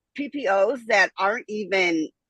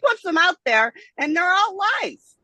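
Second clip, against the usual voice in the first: high-pitched exasperated complaint; rising up in frustration, pitch jumping; heated outburst; 7 semitones higher than usual. Real, not acted. Outburst about ex-husband in court.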